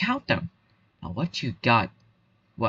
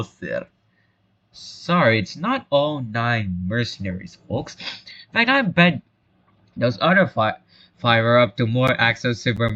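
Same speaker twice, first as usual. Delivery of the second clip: normal talking voice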